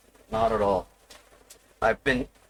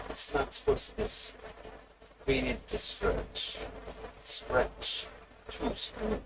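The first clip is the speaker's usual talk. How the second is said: whisper; 9 semitones higher, 9 dB quieter than usual. Real, not acted. Muttering to himself fixing window.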